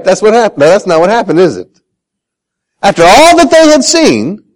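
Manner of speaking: usual speaking voice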